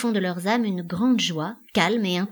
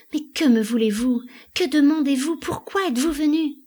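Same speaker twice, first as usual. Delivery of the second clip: high, loud, pressing questions; frantic questioning; 7 semitones higher, 4 dB louder than usual. A narrator's reading. Elida demands why the stranger came, frantic. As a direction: pile up the questions, climbing in pitch.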